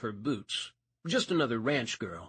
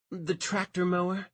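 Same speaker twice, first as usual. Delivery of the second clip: strange note; 7 semitones higher than usual. A narrator's reading. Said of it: "a strange note in her voice".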